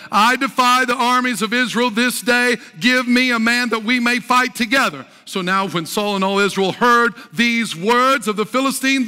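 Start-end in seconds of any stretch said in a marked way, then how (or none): 0.1-5.0 louder, higher preaching declamation of a taunt; Philistine's challenge. Acted. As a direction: read it as a champion's defiant challenge, lightly voiced. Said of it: "And the Philistines said".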